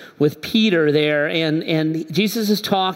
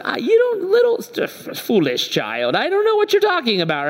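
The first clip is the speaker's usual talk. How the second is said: flustered dismissal; 13 semitones higher than usual. Retold or imitated. Peter brushes off the little girl's accusation, flustered high-pitched bluster. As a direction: stammer high, then brush it off in a high rush.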